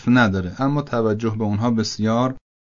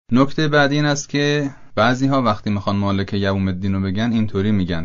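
normal talking voice

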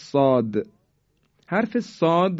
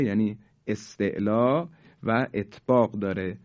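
usual speaking voice

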